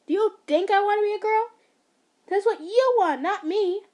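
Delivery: loud, high angry outburst; snapping. Acted. Son snapping at his controlling mother. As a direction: let the years of anger burst out. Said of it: "Nagisa finally snapped".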